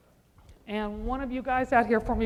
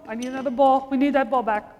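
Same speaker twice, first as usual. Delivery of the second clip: ordinary talk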